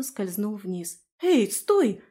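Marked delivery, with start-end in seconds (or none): 1.2-2.0 higher, louder urgent call. A narrator's reading. Heroine after the runaway little dragon, calling out. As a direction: call after someone to stop.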